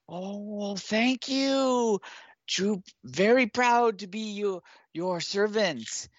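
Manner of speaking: a fawning little goblin thanking his rescuers; grateful goblin. Acted. Game master voices the goblin thanking the party, high and squeaky. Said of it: "and he says".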